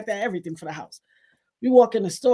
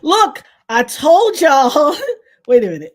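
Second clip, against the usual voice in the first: real laughter breaking through the words; helpless laughter; laughing hard; 4 semitones higher, 8 dB louder, slower than usual. Real, not acted. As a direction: burst out laughing as you speak, slowly. Cracking up reading chat.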